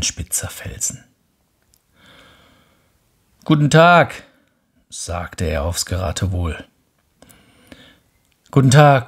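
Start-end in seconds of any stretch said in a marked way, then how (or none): 3.4-4.2 loud high call into the mountains. Acted. Prince calls from the peak, loud hopeful shout. 8.5-9.1 loud high greeting shouted out; calling out. Acted. Prince shouts to the mountains, loud call. Prince calls, echo answers.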